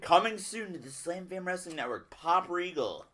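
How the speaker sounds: high-pitched hype voice; mock promo announcer. Co-host teasing friend with a fake podcast ad, mock announcer. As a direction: announce it like a coming-soon ad.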